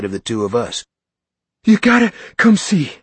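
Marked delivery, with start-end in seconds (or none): 1.6-3.0 put-on high, loud, breathless kid voice; panting excited boy. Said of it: "Billy panted".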